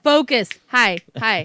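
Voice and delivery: loud, punchy mock call to attention; hammed-up attention-getter, each word on its own; imitating herself getting attention. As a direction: call out to a dazed worker, loudly. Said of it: "I'm like".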